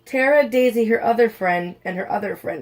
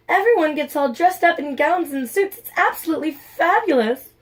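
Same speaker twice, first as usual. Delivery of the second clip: gushing; 7 semitones higher than usual. Acted. Sky gushing over dance.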